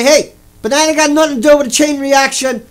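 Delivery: loud, pointed objection. Pretend. Heckler objecting at a nuclear lecture, loud. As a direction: speak up so the whole hall hears. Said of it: "say it nice and loud so everybody can hear it".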